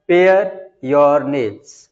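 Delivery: pare your nails, stretched out word by word; very slow dictation. Shown as a demonstration. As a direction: spell it out slowly for the class to write. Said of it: "teacher reads sentence slowly". (बहुत धीरे-धीरे बोलकर लिखवाना)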